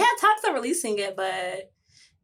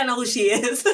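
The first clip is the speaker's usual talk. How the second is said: laughing through words; 2 semitones higher, 5 dB louder than usual. Retold or imitated. Laughing while quoting herself.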